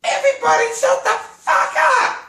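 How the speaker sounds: put-on very high, loud child's shriek; a four-year-old shouting down her family; little girl yelling. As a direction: play a tiny kid screaming at adults. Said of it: "this little girl, four years old ... goes".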